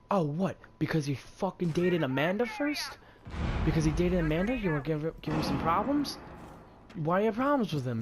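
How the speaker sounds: heated retelling of an argument; retold angry challenge. Retelling his side of an argument.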